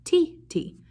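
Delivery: "T, T" спето высоко и чётко; rhythm syllables T T. Shown as a demonstration. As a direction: chant T, T high for the class. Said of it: "Can you say that?".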